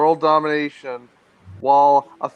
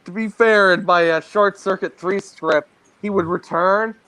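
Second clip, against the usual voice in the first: normal talking voice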